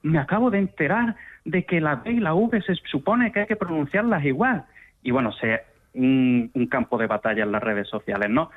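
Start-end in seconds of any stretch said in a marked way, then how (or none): 0.0-4.6 higher, lightly mocking voice of an online poster; lightly acted higher-pitched quote of a poster; social media poster. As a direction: imitate someone announcing a surprise discovery online. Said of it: "de vez en cuando alguien publica algo".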